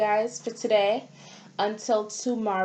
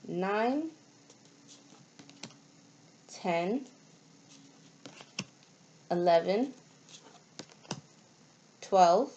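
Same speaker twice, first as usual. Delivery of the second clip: put-on slow counting for kids; slower than usual. Teacher counts slowly for kids.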